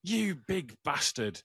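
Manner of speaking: slow, high-pitched mock yell without real volume; retold shouting. Angry stranger insulting me over his phone, retold mock shout. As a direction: draw out the insult like an outraged man. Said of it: "he started, started shouting".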